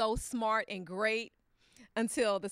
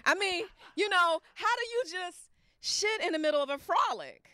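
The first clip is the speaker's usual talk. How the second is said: higher, louder exasperated rant; 8 semitones higher, 4 dB louder than usual. Real, not acted. Grandma ranting about the beach accident, exasperated. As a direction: rant in disbelief. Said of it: "angry because I just can't understand".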